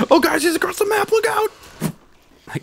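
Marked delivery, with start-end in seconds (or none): 0.1-1.5 squealed mock warning, louder and far higher; very high, loud pretend alarm; Aaron's Warzone reaction. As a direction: imitate a gamer in overblown panic. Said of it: "It's like Aaron's reaction in Warzone".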